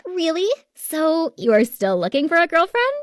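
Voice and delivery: high-pitched coy interest; flirtatious sister. A narrator's reading. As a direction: fish for an opening, voice lifted.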